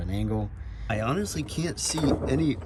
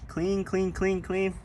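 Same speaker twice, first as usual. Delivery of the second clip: singsong clean; 9 semitones higher, slower than usual. Acted. Showing off the washed car.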